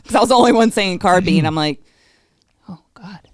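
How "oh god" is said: breathy, voiceless aside to oneself; whispered dismay. Retold or imitated. Guest retelling her doubt over carbine, whispered dismay. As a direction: whisper it as a dawning worry. Retold private worry about pronunciation.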